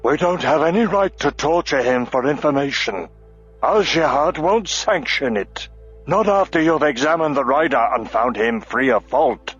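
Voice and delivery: indignant, firm defense; bristling dwarf. A narrator's reading. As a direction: push back hard. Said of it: "Auric bristled".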